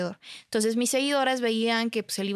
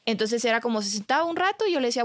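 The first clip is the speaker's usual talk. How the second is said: ordinary talk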